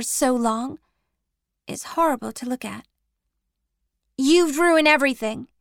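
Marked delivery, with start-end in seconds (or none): 4.2-5.5 loud bitter accusation; angry blame. Acted. Jake blaming Addie, loud and bitter. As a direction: throw the blame at her.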